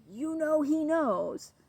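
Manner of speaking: high, loud, slow knowing accusation; knowing owner. Retold or imitated. Owner accusing the dog, high and drawn out. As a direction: draw it out like an owner sure of guilt. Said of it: "when you're inclined to say".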